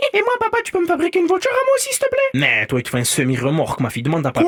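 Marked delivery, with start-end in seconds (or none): 0.1-2.2 put-on very high, fast little girl voice; begging little girl. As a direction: plead like a small girl to her dad. Fillette qui supplie son père. (petite fille qui supplie papa, voix très aiguë et rapide)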